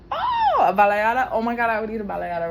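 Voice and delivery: loud, fast eager craving; excited. Real, not acted. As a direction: gush about it.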